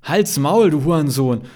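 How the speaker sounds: loud, high-pitched angry yell; angry retort. A narrator's reading. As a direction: fire it back loudly. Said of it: "ballert Kevin zurück". (wütend zurückgebrüllt)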